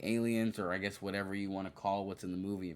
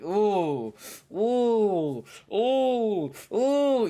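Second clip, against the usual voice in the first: each ooh climbs higher and louder; excited oohs; 12 semitones higher, 10 dB louder, much slower than usual. Retold or imitated. Movie fan imitating her own oohs, rising excitedly. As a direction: ooh rising to a squeal of delight. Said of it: "oohs from me".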